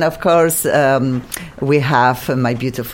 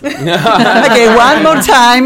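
louder; 7 semitones higher, 7 dB louder, slower than usual. Real, not acted. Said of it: "that went very fast".

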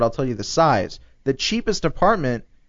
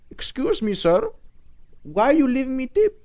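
higher-pitched imitation of an Indian accent; mock Indian accent; 11 semitones higher than usual. Taxi driver puzzled by a tourist's tip, mock Indian accent, higher. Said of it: "most of them were Indian".

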